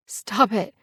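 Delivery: pathetic, choked-up. A narrator's reading. Passenger to taunting biker, choked-up plea. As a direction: plead weakly through a tight throat. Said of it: "I sound pathetic, with the emotion clogging my throat".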